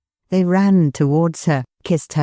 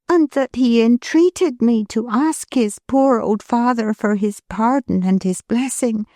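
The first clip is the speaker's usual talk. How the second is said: put-on higher, gentle young wife's voice; Milly, tender; 6 semitones higher than usual. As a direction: lovingly reassure her husband. Milly tells of the sick man.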